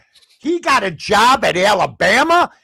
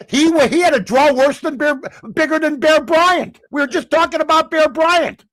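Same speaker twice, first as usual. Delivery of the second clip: normal talking voice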